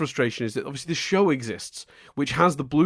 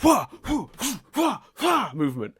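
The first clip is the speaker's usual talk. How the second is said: high, punchy "wah" noises; imitated martial-arts exertion cries; mimicked bending grunts; 10 semitones higher than usual. Mimicking earthbenders moving in unison, short high mock-effort yelps. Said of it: "in unison do this".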